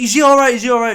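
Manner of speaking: high, loud anxious question; frantic girlfriend on the phone; worried girlfriend. Retold or imitated. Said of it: "Ellen's on the phone going".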